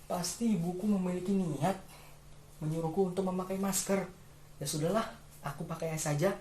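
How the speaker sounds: lightly voiced child reassuring itself; child's inner thought. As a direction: think it through as a resigned child.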